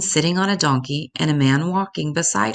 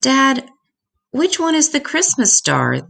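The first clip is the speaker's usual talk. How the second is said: put-on high, eager child's voice; a young boy calling up to his dad; boy's voice; 10 semitones higher, 4 dB louder than usual. A narrator's reading. Boy asking his father about the star, high and eager. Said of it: "the boy asked".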